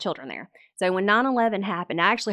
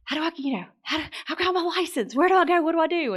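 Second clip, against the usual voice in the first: high-pitched string of pleading questions; asking Dad for help; 9 semitones higher, much faster than usual. Retold or imitated. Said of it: "'cause I would ask Dad".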